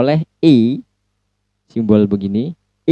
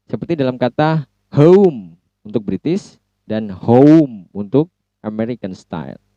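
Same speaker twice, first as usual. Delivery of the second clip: ordinary talk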